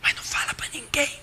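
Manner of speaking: hushed secretive whisper. Retold or imitated. Asker pleads with the pastor to keep it secret, whispering. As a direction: breathe it so nobody near hears.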